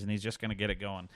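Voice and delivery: ordinary talk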